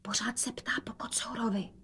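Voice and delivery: very soft voiceless aside; whisper. A narrator's reading. Daughter tells mother about father's cat, whispering. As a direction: whisper it barely audibly. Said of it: "dodala šeptem".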